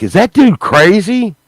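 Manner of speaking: high, loud mock question; mock incredulous bystander. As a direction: squeak out a bystander's disbelief. Mock bystander asking loudly.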